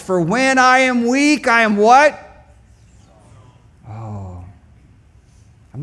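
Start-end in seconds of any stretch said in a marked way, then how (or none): 0.0-1.8 raised, higher call for a response; call to congregation. Shown as a demonstration. Preacher calling the line to his congregation, loud. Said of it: "Let's try that again". 1.8-2.2 sharp high shouted question; prompting call. Shown as a demonstration. Preacher prompting congregation to answer, shouted. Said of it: "prompting crowd".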